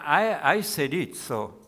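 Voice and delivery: normal talking voice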